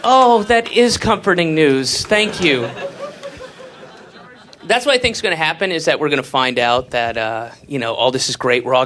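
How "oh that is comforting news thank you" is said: slow, loud sarcastic thanks. Retold or imitated. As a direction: drip sarcasm slowly. Sarcastic reply.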